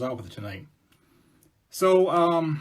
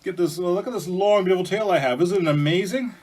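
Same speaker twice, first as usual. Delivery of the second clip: loud, higher-pitched show-off bear; boastful bear; 7 semitones higher, 7 dB louder than usual. Acted. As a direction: brush off the request and show off loudly. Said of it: "And Bear goes".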